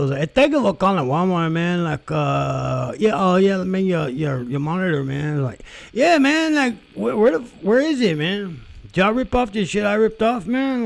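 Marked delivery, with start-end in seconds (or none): 0.2-5.4 imitating a vato. Retelling the support guy on the phone, in a raised vato voice. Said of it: "he's like a total vato". 5.9-10.9 much higher and louder LA vato voice; hammed-up vato. Mocking the support guy's LA accent, much higher and louder. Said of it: "he sounded like he was totally from L A".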